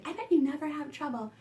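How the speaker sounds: ordinary talk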